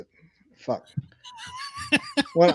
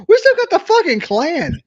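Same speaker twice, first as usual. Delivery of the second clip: much higher and louder, yelling; shouting rant; 11 semitones higher, 5 dB louder than usual. Real, not acted. Heated drunken rant about Birmingham.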